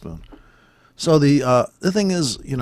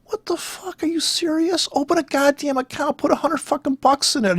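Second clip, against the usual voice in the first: incredulous rant; 13 semitones higher than usual. Real, not acted. Ranting about overpriced courses.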